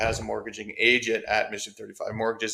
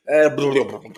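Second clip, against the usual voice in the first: mock babble; 4 semitones higher, 8 dB louder than usual. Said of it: "That's exactly how I thought I felt".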